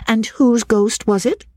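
put-on high-pitched lady pressing a child; Miss Halcombe, questioning. A narrator's reading. Miss Halcombe turns to the boy, pointed questioning.

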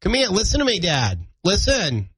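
put-on high little-kid Maine-Boston accent; toddler's Maine accent. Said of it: "but he does".

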